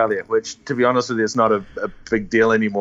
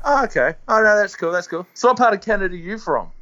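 higher-pitched retold breezy reply; mock-agreeable; 6 semitones higher than usual. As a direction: play it innocent to needle him. Retelling his needling reply to American.

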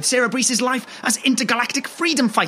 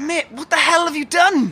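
higher-pitched, alarmed demand; appalled; 5 semitones higher than usual. Acted. Friend on the phone after the hair-pulling, appalled. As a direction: demand an answer in rising alarm.

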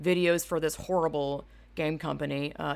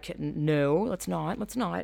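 ordinary talk

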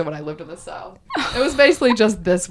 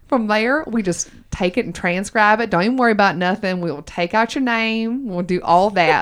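put-on Southern accent. Host explains voicemails to listeners in a Southern accent. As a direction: reassure y'all in a playful Southern accent. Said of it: "in my Southern accent".

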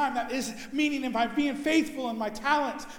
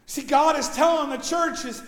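usual speaking voice

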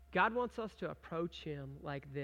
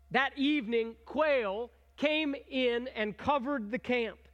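measured, slightly raised reading of the verse; scripture reading; 4 semitones higher, 6 dB louder, slower than usual. Acted. Pastor reading Exodus aloud to the congregation, measured and raised. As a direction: read the passage slowly to the congregation.

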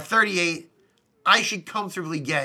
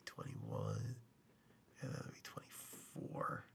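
whispered sums under the breath; muttering mental math; 25 dB quieter, much slower than usual. Real, not acted. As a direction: count quietly to yourself. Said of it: "let me do some quick mental math".

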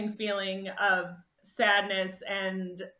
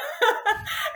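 laughing through tears; 12 semitones higher than usual. Real, not acted. Tearful laugh after retelling.

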